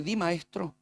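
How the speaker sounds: softly feigned deference to a teacher; falsa cortesía. Retold or imitated. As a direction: answer quietly with two-faced politeness. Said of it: "Falso como moneda de cobre". (respeto fingido, en voz baja)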